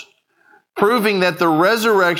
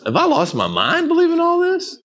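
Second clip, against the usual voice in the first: anxious high doubt; 13 semitones higher than usual. Retold or imitated. Parent's anxious inner thought.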